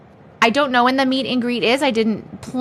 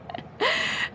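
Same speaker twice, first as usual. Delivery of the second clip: breathy high laugh; 9 dB quieter than usual. Real, not acted. Laugh after line 42.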